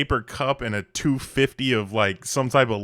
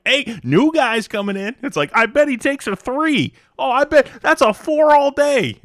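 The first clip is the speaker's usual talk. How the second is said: much higher, louder, hammed-up barber sizing up the new guy; loud mock office barber; 10 dB louder than usual. Office barber betting on the new hire, in a loud, high hammed-up voice. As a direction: call it across the office like a rowdy barber. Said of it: "and then, like".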